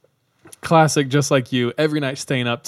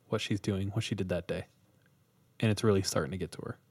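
hushed, lowered campfire story voice; spooky storytelling; 5 dB quieter than usual. Acted. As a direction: draw them into the creepy tale softly.

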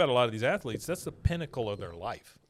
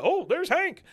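excited greeting; 7 dB louder, slower than usual. Retold or imitated. Retelling cartoon scene, voicing Bill.

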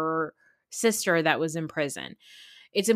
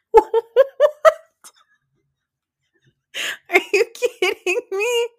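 laughing; 8 semitones higher, 7 dB louder than usual. Real, not acted. Cracking up at gross story.

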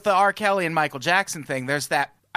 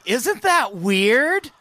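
drawn-out, higher, louder question; slow awkward drawl; 9 semitones higher, 5 dB louder, much slower than usual. Retold or imitated. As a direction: stretch it out in awkward disbelief. Family's awkward reaction quoted.

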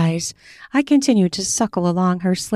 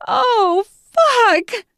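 sharp gasp then loud, much higher cry; 4 dB louder than usual. A narrator's reading. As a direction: yelp out at the paddle hit. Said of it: "cries out".

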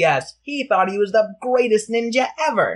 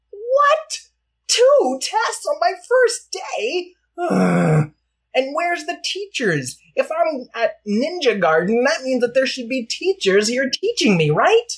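squeaky outraged complaint, pitched up; high whiny indignant kid; 7 semitones higher than usual. Acted. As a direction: whine it like a put-upon kid. Teddy indignant.

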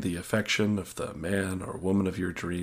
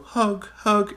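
high, pleading "hug, hug"; needy hug plea; 11 semitones higher, 3 dB louder than usual. Pretend. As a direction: whimper for a hug like a needy child. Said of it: "reach toward them and go".

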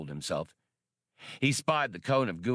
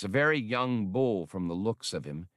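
plain reading voice

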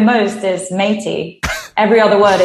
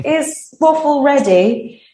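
higher, slow put-on voice, Austin Powers style; hammed-up spy-comedy voice for the sister asking the breeder; mock Austin Powers; 6 semitones higher, slower than usual. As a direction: lift the pitch and draw it out like Austin Powers. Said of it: "And she doesn't talk like that".

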